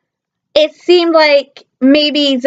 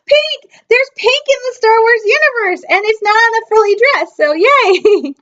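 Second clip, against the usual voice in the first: high, gushing delight; excited squeal; 6 semitones higher than usual. Retold or imitated. Fan squealing to viewers about pink, excited. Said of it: "I'm like".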